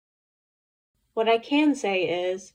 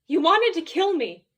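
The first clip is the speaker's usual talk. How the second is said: high, louder accusing cry; screaming; 7 semitones higher, 4 dB louder than usual. Acted. Betrayed girl screaming at the alien, loud accusing cry. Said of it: "I'm screaming".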